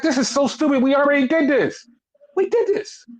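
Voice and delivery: high-pitched indignant complaint; re-enacted outrage, pitch climbing; exasperated protest. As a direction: whine in disbelief at a stupid rerun. Exasperated reaction to repeated storyline.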